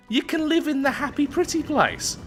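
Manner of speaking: very high, playful sing-song lilt; playful cutesy voice. Pretend. Gamer playfully selling his utopia world, cutesy high voice. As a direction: pitch it way up, mock-sweet.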